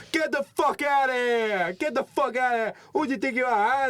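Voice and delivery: high, loud mock New Yorker yelling; New York accent. New Yorkers yelling at the tour bus in a loud, high mock accent. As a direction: bellow like an angry street New Yorker.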